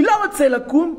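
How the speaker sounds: loud, high mock refusal; defiant child. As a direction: act out a kid's energetic protest. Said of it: "הוא אומר לי". (ילד מתנגד בקול רם וגבוה)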